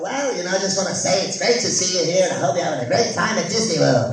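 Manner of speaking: cartoon character voice; Wacko. Voice actor proving himself to a doubting kid, cartoon voice. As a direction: greet the kid in full cartoon voice. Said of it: "Let me hear you say something".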